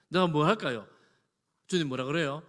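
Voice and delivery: ordinary talk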